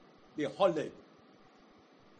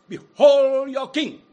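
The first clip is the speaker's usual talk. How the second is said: Pilate's loud, slow, high proclamation; slow ringing call to the crowd; proclaiming; 8 semitones higher, 14 dB louder, slower than usual. Acted. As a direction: present the king to the crowd at full voice.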